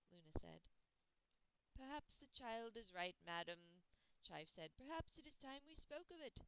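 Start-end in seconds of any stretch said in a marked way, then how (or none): 1.7-6.4 gentle butler. Acted.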